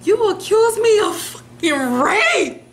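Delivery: [name] impression.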